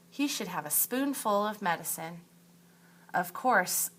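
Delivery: ordinary talk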